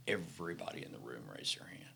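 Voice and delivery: whispered, dropping right down; hushed whisper. Real, not acted. Telling the host in a hushed whisper. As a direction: whisper it like a secret reveal. The room's silent show of hands.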